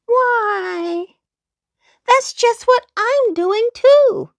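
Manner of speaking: high, delighted little-dog exclaim; thrilled to find a fellow traveller; Fido excited. A narrator's reading. Fido meeting a fellow fortune seeker, high and delighted. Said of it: "exclaimed Fido Flip Flop".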